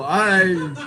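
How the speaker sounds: normal talking voice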